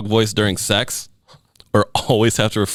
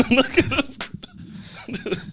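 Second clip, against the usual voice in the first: laughing; 3 dB quieter, much slower than usual. Real, not acted. Laughing at the joke.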